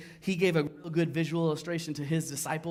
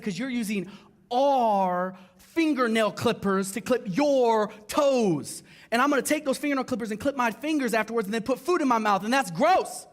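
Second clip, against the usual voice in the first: mock outraged rant; 7 semitones higher, 7 dB louder than usual. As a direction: go up and loud, fed up with the clippers. Retold fight over toenail clippers.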